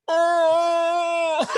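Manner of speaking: pained cry, high and loud; yelling as water hits the thumb; guttural yell. Retold or imitated. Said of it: "deep guttural, like, yell".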